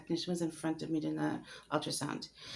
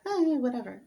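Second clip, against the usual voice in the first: mock careless brush-off, higher and louder; dismissive shrug; 6 semitones higher, 4 dB louder than usual. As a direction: toss it away like it doesn't matter. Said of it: "I was just like".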